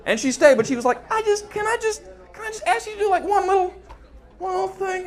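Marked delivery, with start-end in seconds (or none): 1.1-5.1 high, hesitant small voice; timid woman. Retold or imitated. Woman timidly asks him a favor, high and hesitant. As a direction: ask shyly for a favor.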